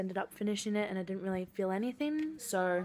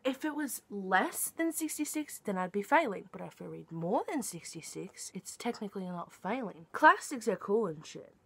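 ordinary talk

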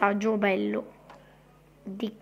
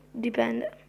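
normal talking voice

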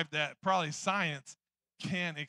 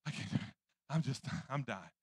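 faint, breathless, softer whispered groan; weak whisper; 4 semitones lower, 7 dB quieter, faster than usual. Retold or imitated. Alone in bed with a collapsed lung, a faint whisper. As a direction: barely get the words out, fading. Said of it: "I'm like".

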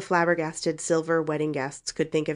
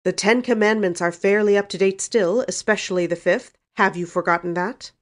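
louder, weighty rebuke; heavy; 4 semitones higher, 8 dB louder than usual. A narrator's reading. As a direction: lay down the law, loudly. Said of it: "said Uncle James heavily".

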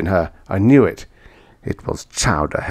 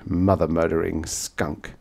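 ordinary delivery

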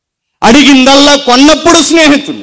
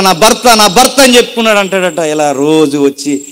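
usual speaking voice

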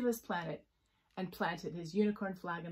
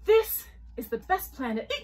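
loud, higher, broken by a hiccup; 5 semitones higher, 10 dB louder than usual. Acted. Jellyfish praises a planet, hiccuping. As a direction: let a hiccup burst in. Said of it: "hiccuped Jellyfish".

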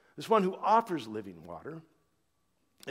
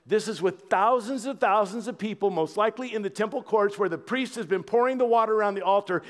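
ordinary delivery

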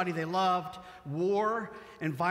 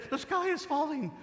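mock panic; 10 semitones higher than usual. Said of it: "Even if they make it to the king".

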